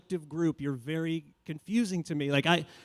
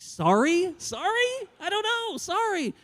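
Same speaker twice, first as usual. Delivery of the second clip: much higher, squeaky apologies; flustered squeaky sorry; slower than usual. Retold or imitated. Baffled man apologizes to the woman, much higher and squeaky.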